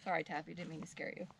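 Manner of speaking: low, very soft apology to a pet; meek apology. Real, not acted. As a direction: drop to a small, hushed sorry. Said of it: "and then you're like, "Sorry".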